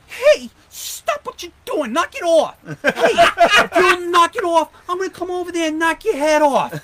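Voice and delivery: high, loud, slowed-down yelling buddy voice; mimicking Gary Baker. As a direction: ham up a hot-headed sergeant barking threats. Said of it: "we kind of would mimic him".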